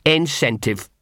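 sour one-word retort from a dog; bitter. Acted. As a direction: spit out the word with a touch of bitterness. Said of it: "said Gaspode bitterly".

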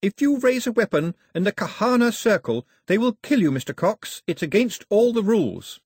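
high, loud warning. A narrator's reading. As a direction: call out the rules forcefully.

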